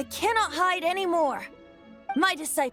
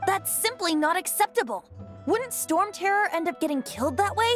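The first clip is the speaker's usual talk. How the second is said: high-pitched, indignant objection; dismayed pleading on the dragon's behalf; alarmed protest; 6 semitones higher than usual. Acted. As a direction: object urgently, pitch raised throughout. Bard protests at dragon being killed.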